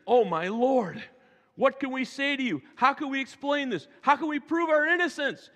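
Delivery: high, pleading, scared; fearful pleading. Acted. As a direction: beg in fear for mercy. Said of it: "Can you hear the fear in him?".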